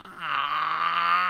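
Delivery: loud, low "ah" from a sore throat; real loud throat groan; throat-trouble groan. Real, not acted. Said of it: "Oh my God, my throat".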